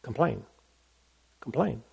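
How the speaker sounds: soft, sulky mutter of complain; muted complaint. Shown as a demonstration. Preacher demonstrating muted grumbling to congregation, soft sulky mutter. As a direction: show a quiet under-the-breath complaint. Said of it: "typically muted way".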